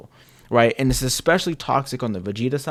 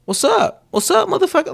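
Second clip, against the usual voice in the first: much higher, sing-song hostile greeting; mock girlfriend greeting; 4 dB louder than usual. Girlfriend greeting her partner every time, much higher and mocking. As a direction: greet him sweetly but with a threat underneath. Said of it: "she like".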